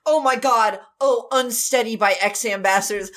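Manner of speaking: ordinary talk, nothing marked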